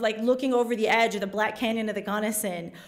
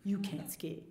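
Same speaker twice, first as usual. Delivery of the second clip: low, much softer deadpan warning; low grave warning; 8 semitones lower, 12 dB quieter than usual. Retold or imitated. Coworker warning her before vacation, low grave hush. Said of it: "he's like".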